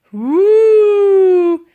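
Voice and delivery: an old lady pretending her bottom misbehaves; hammed-up loud hoots; loud whooping hoots. A narrator's reading. Fake wind noise.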